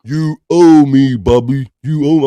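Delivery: loud, slow mock demand; Wendy impression. Host imitating Wendy scolding Bobby, hammed-up. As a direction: drag it out like a wronged friend. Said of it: "I'm trying to do my Wendy impression".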